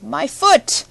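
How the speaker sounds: high, loud zombie wail; a pained, drawn-out cry of my foot. A narrator's reading. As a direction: wail it out in pain. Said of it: "one stiff wailed".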